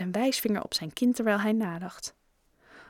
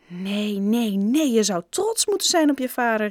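exclaiming; 2 semitones higher, 4 dB louder than usual. A narrator's reading. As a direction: build from a first no to an emphatic exclamation. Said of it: "riep Raj". (nadrukkelijk uitgeroepen)

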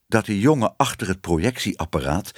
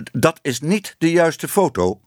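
higher, testy protest; irritated call; 5 semitones higher, 3 dB louder than usual. A narrator's reading. Flustered lecturer objects to the wrong slide, mildly irritated call. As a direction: call it out with a touch of temper. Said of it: "tikte driftig met zijn stok en riep".

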